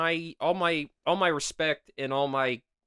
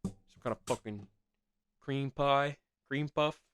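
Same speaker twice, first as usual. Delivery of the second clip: gamer impression. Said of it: "they'd probably just say".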